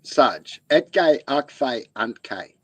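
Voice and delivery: slow syllables, each word ending in a clipped "ke"; teaching pig latin word by word; pig latin demo. Shown as a demonstration. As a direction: sound out Etke, Akfe, Untke as coined words. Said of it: "try it like this".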